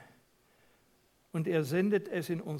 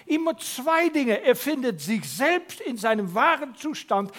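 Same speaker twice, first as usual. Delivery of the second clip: higher, louder preaching, building to grace; forceful preaching; 6 semitones higher, 6 dB louder than usual. Real, not acted. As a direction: proclaim the gracious God with force. (leidenschaftliche Predigt)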